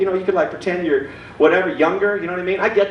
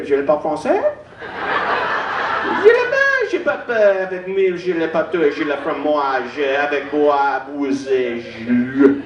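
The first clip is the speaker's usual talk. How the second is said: fake French; 4 semitones lower than usual. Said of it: "pretend that I speak a different language".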